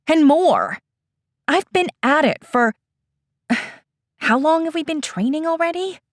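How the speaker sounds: high, fast, exasperated; exasperated complaint. Acted. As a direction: pour out the frustration. Eda's frustrated complaint.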